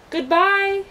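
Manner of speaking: high, loud farewell call; shouted goodbye. A narrator's reading. Milo calling goodbye from the car, loud and high. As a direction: call it out to friends left behind. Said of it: "he shouted".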